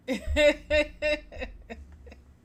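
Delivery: big high laugh; loud laugh. Real, not acted. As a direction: burst out laughing loudly.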